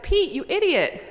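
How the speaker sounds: high, loud exasperated scolding; exasperated God. Acted. God scolds Pete in heaven, exasperated and loud. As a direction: scold him in mock frustration.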